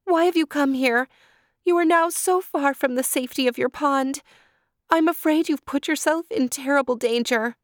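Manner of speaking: put-on high, alarmed young deer; high anxious doe voice; Deer's voice. A narrator's reading. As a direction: cry out his name in worried surprise. Deer frets over Turtle.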